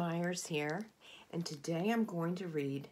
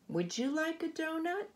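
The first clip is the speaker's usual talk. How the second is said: much higher, sing-song offer; high sweet offer; 11 semitones higher than usual. Acted. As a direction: offer the orca kindly. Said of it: "he asks a great big orca".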